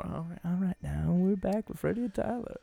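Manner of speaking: breezy DJ back from a meltdown; sixties radio DJ. Acted. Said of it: "some radio D J back in".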